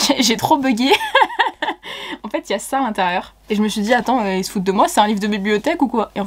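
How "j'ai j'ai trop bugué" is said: amused, higher voice through laughter; real giggly self-mockery; laughing. Real, not acted. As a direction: laugh at your own confusion. Laughs at her own confusion.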